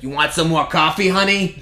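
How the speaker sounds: put-on sweet old diner waitress asking. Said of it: "She's like".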